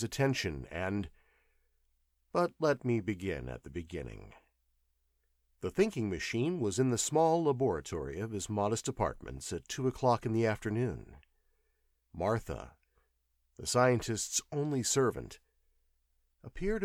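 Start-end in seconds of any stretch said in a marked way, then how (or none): none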